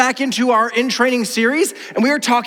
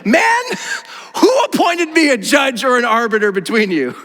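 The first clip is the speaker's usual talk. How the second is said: high, raised-voice protest; exasperated retort; 3 semitones higher than usual. Acted. Jesus answering the man in the crowd, exasperated. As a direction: burst out in indignant disbelief.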